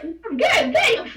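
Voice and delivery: laughing reply. Retold or imitated. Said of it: "And I was like".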